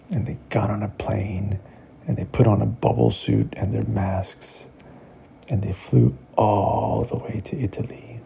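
plain reading voice

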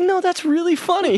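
high, giggly protest; defending himself. Retold or imitated. Answering his annoyed girlfriend in the theater, high giggly protest. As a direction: insist it's funny, half laughing. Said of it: "It's like".

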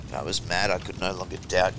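usual speaking voice

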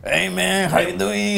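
put-on Mexican accent. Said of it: "that Mexican accent we like to do".

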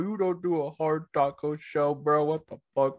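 high, mocking take on his friend; mock bro. Co-host imitating his friend scoffing at taco shells, mock bro voice. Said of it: "you would be like".

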